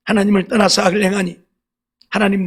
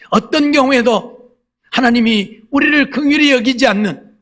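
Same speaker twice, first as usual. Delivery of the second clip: raised, higher sermon voice; forceful preaching; 6 semitones higher, 4 dB louder than usual. Real, not acted. Preacher warning the congregation of God's wrath, forceful preaching. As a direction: press the warning home from the pulpit.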